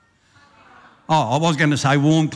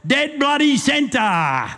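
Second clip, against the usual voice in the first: much higher, very slow triumphant yell; gleeful sergeant's mock victory cry; cried out; 9 semitones higher, much slower than usual. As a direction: drag it out like calling a bullseye. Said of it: "and cried".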